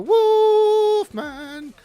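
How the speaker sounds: slow, high, loud howled name; wolf howl name. Pretend. Greeting Wolfman with a howled name call. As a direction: stretch it into a howl.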